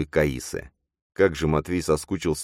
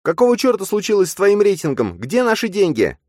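shrill furious yell at her fiancé; 6 dB louder than usual. Acted. Nastya screams at Matvey about his rating. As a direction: scream it at him, high and loud. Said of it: "орала Настя".

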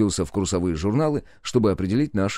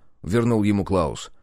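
plain reading voice

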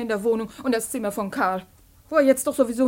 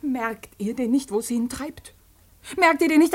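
ordinary talk, nothing marked

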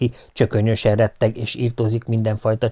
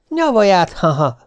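scornful scoff; 7 semitones higher than usual. A narrator's reading. Husband mocks her.

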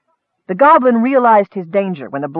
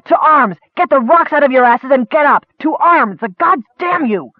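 rousing shout; 7 semitones higher than usual. A narrator's reading. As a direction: bark the men up to fight. Captain rousing the camp.

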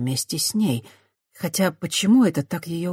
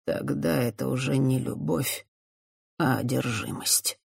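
sad; 2 semitones lower, 6 dB quieter than usual. A narrator's reading. Priest sadly correcting Christiana, softly sorrowful. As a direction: let it fall sadly. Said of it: "грустно заметил священник".

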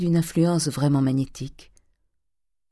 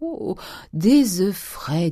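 slow, higher-pitched dandy exclamation; pampered young man's drawn-out reaction; affected dandy delight; 4 semitones higher, much slower than usual. Acted. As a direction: draw it out with airy affectation. Dandy reacts to fresh eggs.

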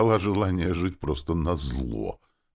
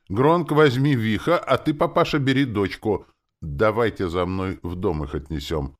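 loud, raised commanding voice; giving orders; 5 semitones higher, 5 dB louder than usual. A narrator's reading. Илья orders the orc and father to carry them, commanding. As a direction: take charge and order everyone along. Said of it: "принялась распоряжаться".

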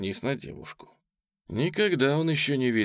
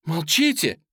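high, loud command; sharp raised-voice outburst; angry shout; 8 semitones higher, 5 dB louder than usual. A narrator's reading. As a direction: cut them off with a cry. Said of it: "крикнула Оля".

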